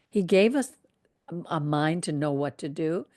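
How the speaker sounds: ordinary delivery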